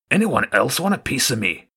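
lightly voiced rat, taunting. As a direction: toss off the challenge lightly. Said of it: "asked lightly".

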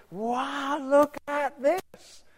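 awed wow. Pretend. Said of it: "I think".

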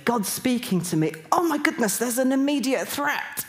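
rising to a high, urgent cry; alarmed exclamation. Retold or imitated. Reflecting on that dream immediately, alarmed and amazed. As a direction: act out the dawning alarm.

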